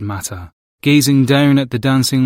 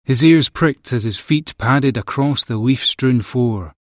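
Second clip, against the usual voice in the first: ordinary delivery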